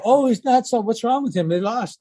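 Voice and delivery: high mock voice of the fans praising the coach. Coach mocking fickle fans after a win, in a high sarcastic voice.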